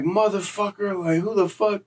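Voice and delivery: high, mock-outraged snap; snapping off. New inmate snapping back at the catcaller: high indignant outburst. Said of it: "Snapping off on Buddy".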